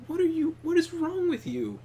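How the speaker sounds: high-pitched retold disbelief; incredulous scolding. Retelling being impolite to a card opponent, incredulous. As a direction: re-enact an exasperated telling-off at normal volume.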